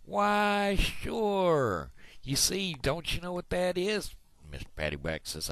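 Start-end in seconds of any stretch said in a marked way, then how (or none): 0.0-4.1 high-pitched, drawn-out bank manager reply; excited bank manager. Acted. Bank manager answers puzzled Paddywhack, high and emphatic. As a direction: let the manager's voice leap way up. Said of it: "the bank manager took one look at it, and he says".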